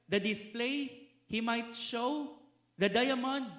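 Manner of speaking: slow, high, loud scripture reading; solemn public reading of scripture; emphatic verse reading. Acted. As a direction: savour each phrase of the passage.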